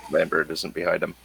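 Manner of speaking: normal talking voice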